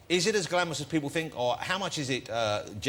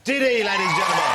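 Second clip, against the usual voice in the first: announcer shout; 5 semitones higher, 7 dB louder than usual. Real, not acted. Host introduces guest to audience.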